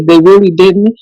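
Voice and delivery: usual speaking voice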